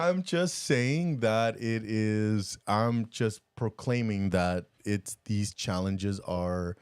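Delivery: very slow, drawn-out declaration; slow, deliberate self-justifying announcement; mock proclamation. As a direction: stretch each word out as if proclaiming. Answers teasing about high horse, proclaiming.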